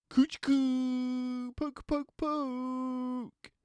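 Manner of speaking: high, playful teasing voice; teasing cub. A narrator's reading. Cub mates bothering Boris, high and playful. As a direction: tickle him with words.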